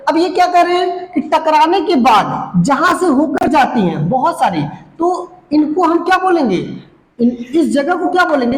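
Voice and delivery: ordinary talk, nothing marked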